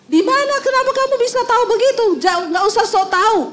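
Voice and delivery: high, fast, indignant denial; defensive outburst. Retold or imitated. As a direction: bluster to cover being caught, high and quick. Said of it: "Dia bilang". (suami kaget dan marah membantah istri, suara tinggi dan cepat)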